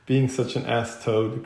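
plain reading voice

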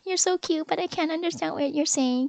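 high, sweet, apologetic manager; imitated cooing manager. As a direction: coo it up high, kindly confused. Retells the manager's cooing reply.

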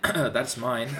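cracking voice. Real, not acted. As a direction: voice breaks on a word. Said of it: "Why did my voice crack?".

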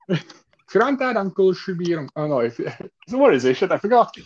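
put-on high, playful Scouse accent; Liverpool accent. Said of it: "Give me your best Liverpool accent right now".

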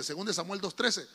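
normal talking voice

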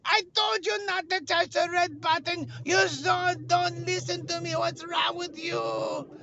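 very high wailing scold; scolding friend. Retold or imitated. Josh chases him in a dream, wailing reproach. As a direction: wail it out, slowing at the end.

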